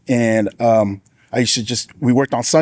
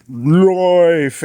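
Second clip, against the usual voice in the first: choked; 4 semitones higher, 3 dB louder than usual. Retold or imitated. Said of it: "I couldn't get the words out to say".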